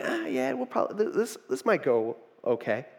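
half-hearted hope, barely daring to say it; tentative hope. Retold or imitated. Peter unsure at ministry start.

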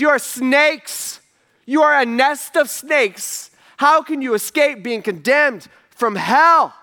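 slow, high, loud condemnation; Jesus rebuking. Retold or imitated. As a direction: hammer each word out slowly, like a prophet's rebuke. Jesus denouncing the religious leaders.

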